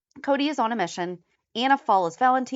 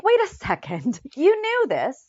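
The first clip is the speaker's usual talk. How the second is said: mock incredulous; 6 semitones higher than usual. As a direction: stretch it out and pitch "You knew this" up. Acting out an incredulous character's reply.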